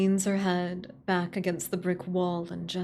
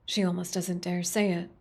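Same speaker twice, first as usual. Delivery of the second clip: normal talking voice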